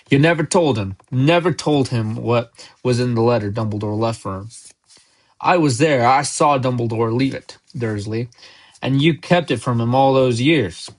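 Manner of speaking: ordinary delivery, nothing marked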